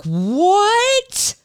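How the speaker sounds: sharp high startled what; knee-jerk shock. Retold or imitated. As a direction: blurt it out high in sudden disbelief. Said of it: "just this knee-jerk".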